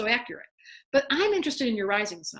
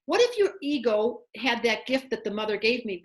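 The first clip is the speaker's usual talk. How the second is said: normal talking voice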